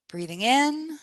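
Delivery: soft, unhurried meditation voice with spaced phrases; guided meditation. Acted. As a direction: guide them gently into relaxation, pausing between phrases.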